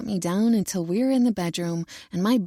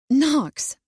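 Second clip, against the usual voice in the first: sharp, raised scolding call of his name; 6 semitones higher, 5 dB louder than usual. A narrator's reading. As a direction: scold him by name, louder. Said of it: "I scold".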